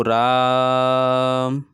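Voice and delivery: slow, stretched pronunciation of राम; drawn-out Ram. Shown as a demonstration. Teacher stretching राम as a pluta example, drawn out. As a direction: hold the vowel of राम long. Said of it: "जैसे राम बोलते हैं ना".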